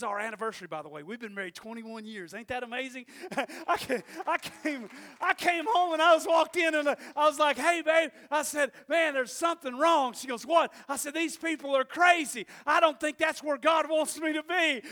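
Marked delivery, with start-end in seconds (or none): 3.7-14.8 higher, louder preaching; shouting. Acted. Preacher telling the congregation, shouting. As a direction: build the story at full force.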